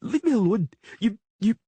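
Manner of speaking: put-on girl shrieking at the Witcher, high and loud; shrieking princess. A narrator's reading. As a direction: spit it at him, stuck for words.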